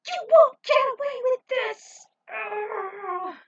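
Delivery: high, loud scream breaking into a groan; desperate scream. Acted. Tinsel screaming after the fleeing villains, desperate scream. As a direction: scream after them with all you have. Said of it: "That burst caused her body to throb".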